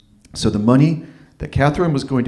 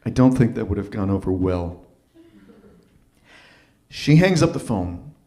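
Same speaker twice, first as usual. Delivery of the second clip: ordinary talk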